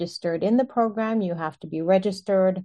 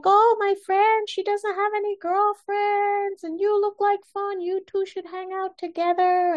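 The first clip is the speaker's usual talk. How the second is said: much higher, lightly voiced pushy friend; male friend's voice; 13 semitones higher than usual. Male friend pitching the girl to the speaker, high and eager. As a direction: play the eager friend selling the idea.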